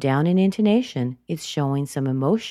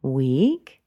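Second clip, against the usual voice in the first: "week" swept upward; unfinished, rising "week"; rising intonation; 2 semitones lower than usual. Shown as a demonstration. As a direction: lift "week" as if continuing. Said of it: "she went up in intonation".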